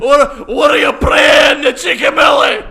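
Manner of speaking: loud, high Scottish shout; Scottish accent. Retold or imitated. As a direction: yell it in an over-the-top Scottish accent. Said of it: "talk in a Scottish accent".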